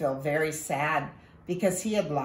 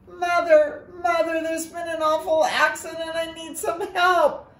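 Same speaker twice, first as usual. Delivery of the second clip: high, loud call for help; boy Ko calling loudly to his mother; 12 semitones higher, 3 dB louder than usual. Acted. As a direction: shout for mother from another room.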